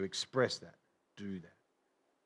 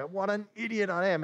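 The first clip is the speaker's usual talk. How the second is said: higher-pitched mock self-scolding; self-reproach; 8 semitones higher than usual. Preacher acting out a sinner's afterthought, mock self-reproach. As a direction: kick yourself, acted out. Acts out regret after sinning.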